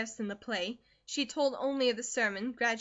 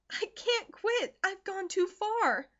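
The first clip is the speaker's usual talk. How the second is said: much higher, slow anguished plea; desperate refusal; 10 semitones higher, 4 dB louder, slower than usual. Acted. Daughter refuses to quit, high and slow. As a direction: hold firm through tears.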